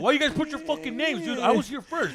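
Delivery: loud, high mock protest; imitated angry complaint. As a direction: act out a queue-jumping grievance. Acts out man quarrelling over list.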